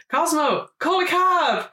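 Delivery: high, hammed-up call to a friend; mock calling out. Retold film gag, calling out.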